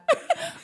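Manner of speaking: sudden high-pitched laugh; quick high giggle; high squealing laugh. Real, not acted. Laughs at own retort.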